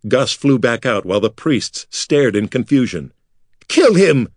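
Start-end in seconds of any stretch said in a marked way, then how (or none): 3.7-4.3 high, loud furious scream; screamed in rage. Acted. Makar ordering priests to kill the impostor, furious scream. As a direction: order the kill at full fury. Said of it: "Makar screamed in rage".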